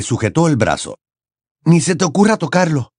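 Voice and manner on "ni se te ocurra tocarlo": warning. A narrator's reading. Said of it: "le advirtió".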